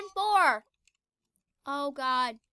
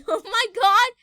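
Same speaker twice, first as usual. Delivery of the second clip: laughing; 4 semitones higher, 10 dB louder than usual. Real, not acted. Laughing at glitch.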